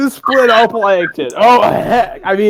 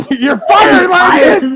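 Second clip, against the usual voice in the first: higher, louder yell at the end; mock angry boss shout; 10 semitones higher, 5 dB louder, slower than usual. Dubbing the boss firing Langton.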